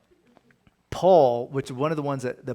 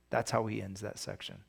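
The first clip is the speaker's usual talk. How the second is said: ordinary delivery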